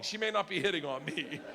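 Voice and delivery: ordinary talk, nothing marked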